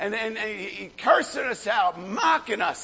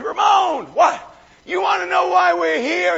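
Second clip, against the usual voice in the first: higher, louder call right at him; retold shout; 9 semitones higher, 8 dB louder than usual. Preacher retelling confronting the gang member, loud calling out. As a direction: grab him with the voice.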